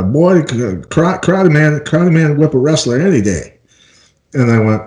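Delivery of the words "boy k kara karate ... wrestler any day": a light Southern country twang; a hint of a Southern accent. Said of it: "in his southern twang".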